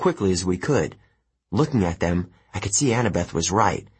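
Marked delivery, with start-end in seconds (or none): none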